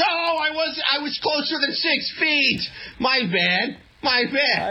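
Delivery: high, sheepish mock excuse; sheepish politician. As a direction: shrug it off like a caught hypocrite. Voicing caught politician's excuse.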